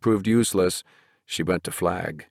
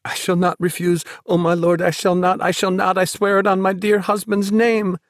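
high, pleading, fearful oath; frantic vow; 11 semitones higher, 4 dB louder than usual. A narrator's reading. Woman on her knees before Flag, frantic pleading. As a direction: swear it desperately in a high voice.

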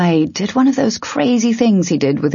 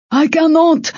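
high-pitched desperate-sounding shout; 12 semitones higher, 4 dB louder than usual. A narrator's reading. Icy refusing to fight mid-battle, shouted. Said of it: "Icy shouted".

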